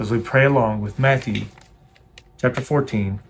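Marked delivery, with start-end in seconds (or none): none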